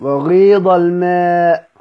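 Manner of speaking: وغيض الماء recited slowly with the madd in الماء drawn out; recitation demo. Shown as a demonstration. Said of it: "حرف المد تقدم على الهمز".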